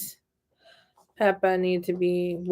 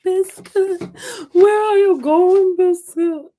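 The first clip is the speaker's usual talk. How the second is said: high, loud calling out for a dog; reading to a toddler; 10 semitones higher, 7 dB louder, slower than usual. Acted. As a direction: call the puppy like a storybook mom.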